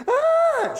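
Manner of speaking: very high, loud mock shriek; imitated child's scream. Little girl walking in screaming, imitated shriek. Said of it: "She's screaming at the top of her lungs".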